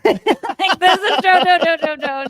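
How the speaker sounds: high, loud, laughing repetition of Joe; gush, nearly squealing; excited. Real, not acted. Gleeful about song's subject.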